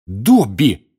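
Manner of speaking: sudden startled shout; startled cry. A narrator's reading. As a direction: cry out in shock. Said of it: "вскрикнул Гарри".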